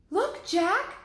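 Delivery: put-on high, loud excited girl's cry; excited call. Annie calls Jack to look at the tall man: loud excited cry. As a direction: point and call out to him eagerly.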